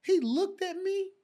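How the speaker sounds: normal talking voice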